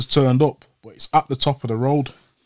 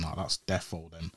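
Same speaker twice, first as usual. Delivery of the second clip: much softer and lower, held breath; hushed inner thought; 7 semitones lower, 15 dB quieter than usual. Retold or imitated. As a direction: think it silently, scared stiff. Lying still, thinking car is shooters.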